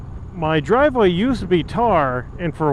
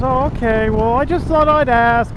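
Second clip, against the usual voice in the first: lady neighbor voice; 7 semitones higher than usual. Retold or imitated. Neighbor quoted in story.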